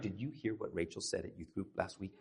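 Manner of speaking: low, hushed gossipy aside; mock gossiping. Pastor playing himself gossiping in life group, hushed. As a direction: pretend to pass on gossip under your voice. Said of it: "If you catch me gossiping".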